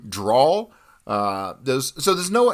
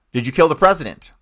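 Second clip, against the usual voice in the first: louder, higher called-out question; reporter's shout; 6 semitones higher, 6 dB louder than usual. Retold or imitated. Reporter shouting at Oswald in police custody, loud. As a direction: call it across a crowded hallway.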